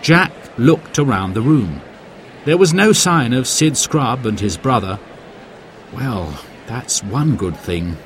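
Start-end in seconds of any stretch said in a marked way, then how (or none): 5.9-8.0 slow, softer inner voice; hushed musing; private thought. A narrator's reading. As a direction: think it to yourself, slow and low-key. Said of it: "thought Jack".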